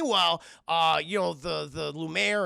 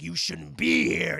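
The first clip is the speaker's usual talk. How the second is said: drawn-out, louder mock-angry Beast; pissed-off Beast; 5 dB louder, slower than usual. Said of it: "He's just very pissed off".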